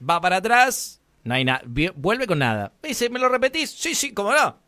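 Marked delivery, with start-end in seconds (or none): 3.1-3.7 higher-pitched clerk voice; politely asking to repeat, pitched up; clerk's question. Retold or imitated. Clerk asks customer to repeat it, higher voice. 3.7-4.6 high, eager acted reply; readily oblige, pitched up; over-obliging. Customer agrees to repeat it to the clerk, over-obliging.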